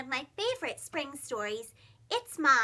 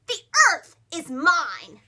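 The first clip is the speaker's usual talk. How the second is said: loud, slow, greedy shout; 11 dB louder, slower than usual. Acted. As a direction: claim the earth loudly and slowly. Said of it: "shouted Rupert".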